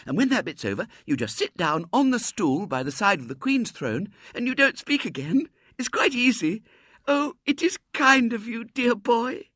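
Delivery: put-on high, loud, excited child voice; eager little girl. A narrator's reading. Girl coaching William for the play, eager high voice. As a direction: bubble over with eager instructions.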